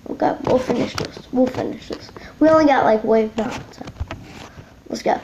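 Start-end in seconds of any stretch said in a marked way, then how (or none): 0.1-2.1 whispering. Real, not acted. Quiet gamer aside.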